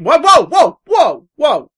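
high, loud excited whoas; real surprised excitement. Real, not acted. Reacts to email subject.

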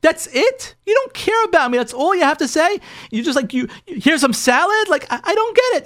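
high, incredulous retold wife; imitated exasperated disbelief; beside herself. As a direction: act out an upset wife, pitch soaring with indignation. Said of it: "she's, like, beside herself".

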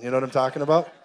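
ordinary delivery

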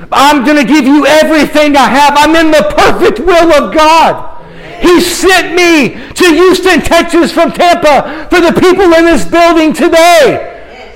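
high, impassioned pulpit declaration; fervent preacher's climax; preaching at full force. Real, not acted. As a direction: proclaim it to the whole room, pitched well up.

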